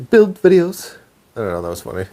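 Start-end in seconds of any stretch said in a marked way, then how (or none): 0.1-0.9 high mimicking Ramsay-style cadence; teasing Ramsay imitation, pitched up; Chef Ramsay. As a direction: repeat it as a Chef Ramsay take-off. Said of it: "Chef, Chef Ramsay there".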